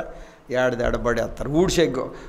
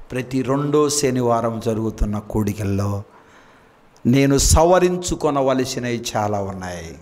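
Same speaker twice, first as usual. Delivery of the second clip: pastor's hushed self-searching appeal; 5 semitones lower than usual. Acted.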